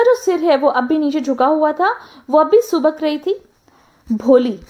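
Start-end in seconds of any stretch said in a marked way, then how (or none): 4.1-4.6 a gentle, soothing voice calling Bholi; the teacher gently calls the shy girl by name, soft and soothing. Acted. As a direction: call Bholi softly, like a kind teacher. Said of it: "the teacher's voice was so soft and soothing".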